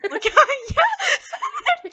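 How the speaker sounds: high, drawn-out shout through laughter; laughing shout. Real, not acted. Player warning a friend about chunky aliens, laughing shout. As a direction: yell it high while laughing.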